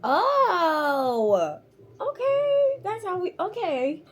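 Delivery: high, thin squeak after a laughing fit; squeaky, spent from laughing; recovering from laughter. Real, not acted. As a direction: try to collect yourself, voice still high.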